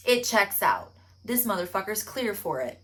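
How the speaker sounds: normal talking voice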